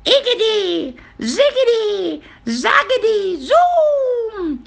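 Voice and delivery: very high incantation, each word set apart; building spell ending on a long "zoom"; witch casting a spell. A narrator's reading. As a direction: chant magic words in a high witch voice. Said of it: "she muttered a spell".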